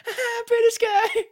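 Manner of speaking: very high, loud squeal; excited falsetto woman impression; imitating hippie chick. Hippie woman thrilled by the British guy, squealed imitation. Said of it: "And she's like".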